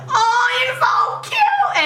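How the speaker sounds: high-pitched drawn-out fangirl squeal; gushing squeal. Retold or imitated. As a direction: squeal it slowly, melting with adoration. Gushing over the idol's sweet words.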